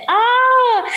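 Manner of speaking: idol's surprised gasp. Retold or imitated. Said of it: "Y dice".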